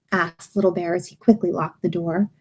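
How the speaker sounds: ordinary delivery, nothing marked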